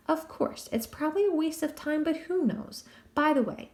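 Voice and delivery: put-on a smug young fairy teasing; Puck's voice.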